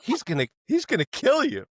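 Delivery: retold girlfriend's high-pitched warning; alarmed warning. Girlfriend warning him about the rugby game, retold alarm.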